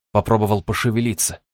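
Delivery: ordinary delivery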